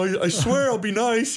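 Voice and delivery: high, pleading self-defence; imitating an anxious appeaser; mock hand-wringing. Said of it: "hand wringing".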